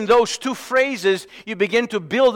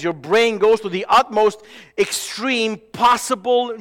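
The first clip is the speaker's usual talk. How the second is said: normal talking voice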